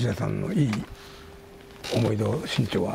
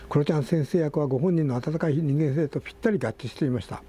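higher, steadier reading voice than in chat; article read-aloud; 5 semitones higher than usual. Real, not acted. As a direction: read the tribute out to the listeners.

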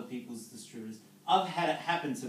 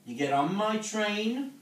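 louder, slower, driving the point home; rising to preaching; 6 semitones higher, 6 dB louder than usual. Acted. Motivational speaker rallying his team, loud and slow. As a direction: preach it to the room.

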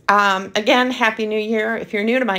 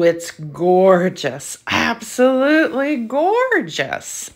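slow, drawn-out admiration, rising high; swooning over a gorgeous color; gushing; 3 semitones higher, slower than usual. Real, not acted. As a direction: savour every word as you gush.

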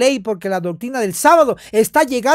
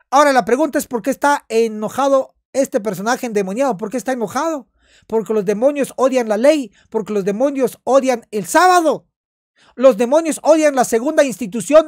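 normal talking voice

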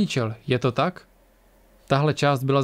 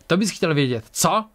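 barked taunt; 3 semitones higher than usual. A narrator's reading. Attacker to the one asking who he is, barked. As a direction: bark it back as a challenge. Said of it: "štěkl muž".

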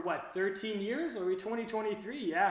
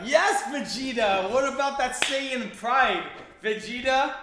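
excited fan; 4 semitones higher, 5 dB louder than usual. Real, not acted. Fan hyping favourite character.

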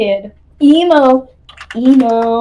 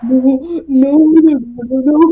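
ordinary delivery

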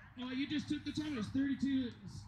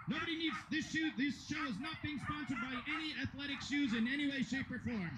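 raised, lively commentator voice; live play-by-play; 6 semitones higher than usual. Acted. Announcer calling a dog agility run, lively play-by-play. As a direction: call the run as it happens.

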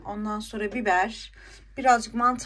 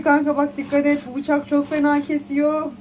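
insistent retold warning, louder and higher; emphatic re-enacted caution, voice raised; 4 semitones higher, 6 dB louder than usual. Vlogger retelling her warning to a friend about the knife, emphatic warning.

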